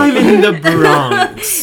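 put-on slow, loud hammy Bronx accent with a laugh. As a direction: jokingly switch to a New York Bronx voice. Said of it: "that wasn't very Bronx".

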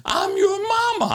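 very high squeaky falsetto, gently calling; mother's new squeaky voice. Retold or imitated. Mother calling her son on the phone, very high squeaky falsetto. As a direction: squeak it way up like her voice after surgery.

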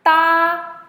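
high, level, loud "Da"; first tone. Shown as a demonstration. Teacher running through four tones on one sound, "Da" high and level. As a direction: hold "Da" high and flat. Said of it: "all four tones in one go".

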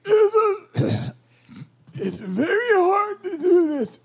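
high, slow, squeezed goblin; strained goblin voice. Acted. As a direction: push it out in a strained voice. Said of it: "that accent and the drained voice".

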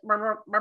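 rolled lips buzzing like rumble strips; rumble strip brr. Retold or imitated. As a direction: buzz out the rumble strip noise. Imitating rumble strip noise.

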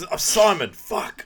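high, loud outburst after botching it; flustered self-correction. Retold or imitated. Botching his own name while introducing himself, mock flustered outburst. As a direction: blurt the correction in mock frustration.

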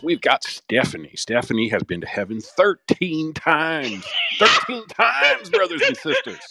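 booming televangelist welcoming the flock, stretching the big words; hammed-up radio preacher introducing special guests; old-timey preacher voice. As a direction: sell the broadcast like a revival preacher, grand and warm. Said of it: "go ahead and bust out that voice for us".

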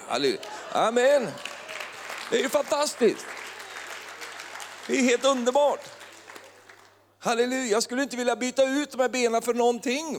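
high-pitched jubilant outburst; exultant preaching. Real, not acted. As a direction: rejoice in a sustained high voice. Exulting over his short legs. (jublande predikan i högt tonläge)

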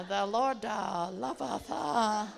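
loud, hammed-up fake Italian accent; mock Italian. Traveller practising a message for the stranger, loud mock Italian. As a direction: drag out endings in clownish Italian. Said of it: "trying to practice what I'm going to say".

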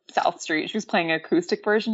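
ordinary delivery

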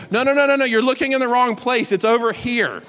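mock bossy kid pointing the way. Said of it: "this little boy goes".

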